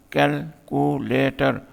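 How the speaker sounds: Calculator slowly in four separate beats; split into syllables. Shown as a demonstration. Teacher giving a four-syllable example, split into syllables. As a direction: break calculator at every syllable. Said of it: "four syllable है".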